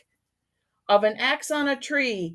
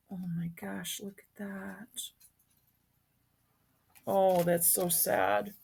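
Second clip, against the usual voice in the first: hushed sad aside; 9 dB quieter than usual. Real, not acted.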